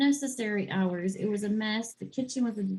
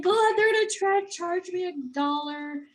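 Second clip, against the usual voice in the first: high, loud, exasperated whine; complaining to herself about the no-show fee, high and whiny; 8 semitones higher, 4 dB louder than usual. Retold or imitated.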